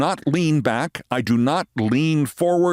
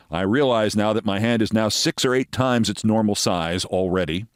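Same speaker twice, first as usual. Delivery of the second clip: normal talking voice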